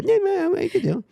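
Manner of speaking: high mock host banter; Jay Leno voice. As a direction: ham up the host, teasing the guest.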